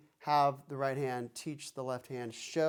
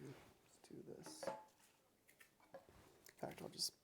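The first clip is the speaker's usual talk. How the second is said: whispering; 21 dB quieter than usual. Real, not acted. Quiet aside while fitting brace.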